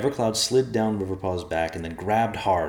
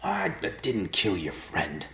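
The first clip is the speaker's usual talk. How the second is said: slow, huffing gravelly denial; grumbling gravelly stranger; huffy gravelly voice; 2 semitones higher, slower than usual. Acted. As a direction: huff it out gravelly and offended. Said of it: "the voice huffed".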